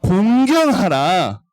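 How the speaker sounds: 공경하라 said slowly, each syllable clear; careful 공경하라. Shown as a demonstration. Teacher correcting children's misreading, slow and clear. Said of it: "공격하라 아니에요".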